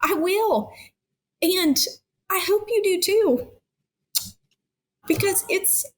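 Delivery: pleading through real tears; tearful plea. Real, not acted. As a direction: voice lifted high and teary. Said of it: "typically I cry like this".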